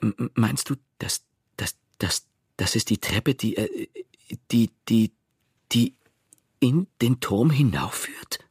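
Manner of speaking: halting stutter, stuck on words; stammering. A narrator's reading. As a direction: stammer the question out with repeated little breaks. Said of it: "und stotterte". (stotternd, stockend gefragt)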